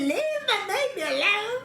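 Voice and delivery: high, laughing protest; laughing cry. Retold or imitated. Mother telling the doctors to leave her baby, high and laughing. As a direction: squeal it out through laughter.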